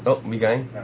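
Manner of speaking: usual speaking voice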